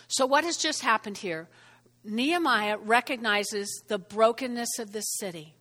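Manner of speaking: normal talking voice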